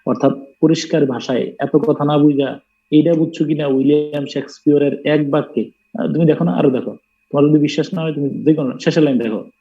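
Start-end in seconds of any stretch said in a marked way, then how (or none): none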